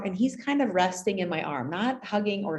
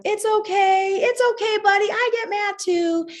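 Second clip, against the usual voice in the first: much higher, louder sing-song, slowed; kids forgiving; 8 dB louder than usual. Retold or imitated. Classmates forgiving the boy, high sing-song kid voices. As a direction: chime in sweetly like kindergartners.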